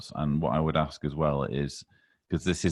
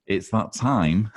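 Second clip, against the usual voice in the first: drawn-out, raised announcer voice; slow announcement; 6 semitones higher, 5 dB louder, slower than usual. Acted. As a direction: stretch it out like a big reveal.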